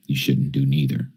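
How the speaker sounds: normal talking voice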